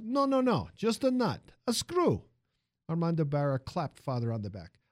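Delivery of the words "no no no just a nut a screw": put-on high lively Italian accent. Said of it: "the music of the man's accent".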